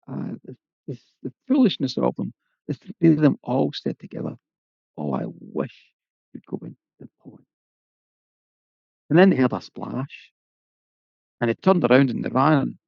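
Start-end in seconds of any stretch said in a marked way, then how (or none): 5.0-7.4 hushed wish. Acted. Three maids wishing together, hushed.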